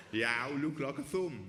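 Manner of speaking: slow, lifted Brummie heckle; Birmingham accent. Retold or imitated. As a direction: mimic the heckler's Brummie vowels, slowly. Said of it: "this only works in a Birmingham accent".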